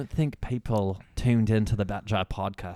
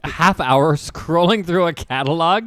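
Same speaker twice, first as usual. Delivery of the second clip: incredulous; 5 semitones higher, 9 dB louder, slower than usual. Real, not acted. Incredulous at co-host's story.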